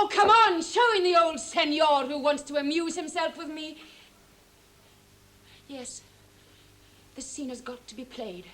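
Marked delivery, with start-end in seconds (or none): 0.0-3.8 loud, high burst that eases off; impatient call. Acted. Stepdaughter demanding the old senor's entrance, loud and impatient. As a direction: call out brashly to get things moving.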